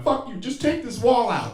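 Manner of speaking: high, pained snap; desperate outburst. Retold or imitated. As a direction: lash out from the pain. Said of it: "I'm like".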